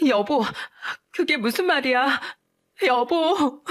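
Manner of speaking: clinging to him, voice rising in panic; tearful pleading. Acted. Wife begging husband not to leave, tearful pleading. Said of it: "동서는 울면서 매달렸어요".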